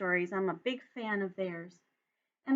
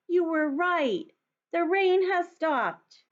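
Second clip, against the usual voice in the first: higher, slow delighted Toad; happy Toad; 8 semitones higher, 5 dB louder, much slower than usual. Acted. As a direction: savour the good news. Toad happily sees rain stop.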